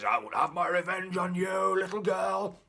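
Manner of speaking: mock-sinister devil threat; the devil vowing revenge on the girl, mock menace; threatening devil.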